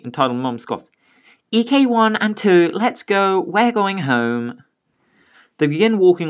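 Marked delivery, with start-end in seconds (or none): 1.5-4.6 scoffing mom. Acted. Mom scoffs, calls sons.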